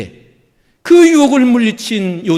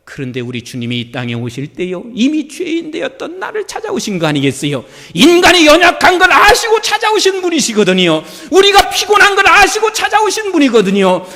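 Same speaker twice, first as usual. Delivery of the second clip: ordinary talk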